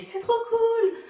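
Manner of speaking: high, loud, drawn-out squeal of approval; gushing fans. Retold or imitated. Imitating people reading her t-shirt, gushing. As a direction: gush it high and loud, stretching the words. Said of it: "Ou encore ils font".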